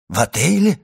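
exclaiming. A narrator's reading. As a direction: blurt it out in shock. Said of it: "воскликнул Вильфор". (удивлённое восклицание)

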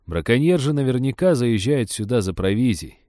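plain reading voice

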